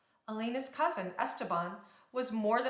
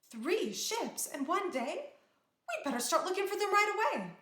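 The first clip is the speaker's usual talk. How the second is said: alarmed; 10 semitones higher than usual. A narrator's reading. Princess alarmed by theft.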